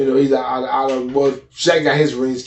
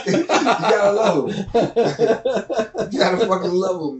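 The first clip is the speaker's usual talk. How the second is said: laughing; 5 semitones higher, much slower than usual. Real, not acted. Laughing about the old days.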